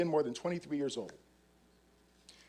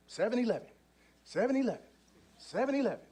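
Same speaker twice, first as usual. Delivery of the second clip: lightly voiced dice gambler, pitched up; in-character dice player; 7 semitones higher, 3 dB quieter, faster than usual. Undercover officer playing at a dice game, lightly in character. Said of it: "I'm in character".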